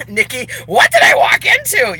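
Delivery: high and loud; surprised outburst. Real, not acted. Asking a viewer what she walked into, surprised and loud. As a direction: burst out in disbelief.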